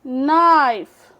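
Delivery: Knife stretched very slowly; slow demo. Shown as a demonstration. Teacher to students, slow knife. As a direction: drag knife out, silent K. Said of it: "K is the silent letter".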